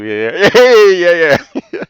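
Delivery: high, loud whooping yeahs with laughter; imitated laughing. Retelling Miss King's amused reaction, imitated laughing. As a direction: crack up while cheering in agreement. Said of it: "He was laughing about that".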